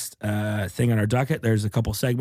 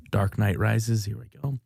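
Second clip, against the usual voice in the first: ordinary talk, nothing marked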